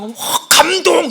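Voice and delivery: high, very slow gush of wonder; mock awe. Preacher mimicking someone moved at every meeting, hammed-up awe. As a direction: stretch it out as if overwhelmed. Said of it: "볼 때마다 막 놀래요".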